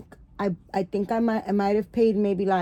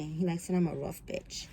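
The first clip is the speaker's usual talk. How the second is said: whisper; 2 semitones lower, 8 dB quieter than usual. Real, not acted. Aside, unvoiced.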